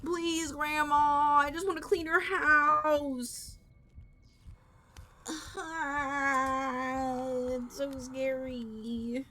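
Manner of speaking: slow, drawn-out frightened begging; whiny pleading. Real, not acted. Player begging Grandma to let them clean, whiny fear. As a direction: plead in long wailed vowels.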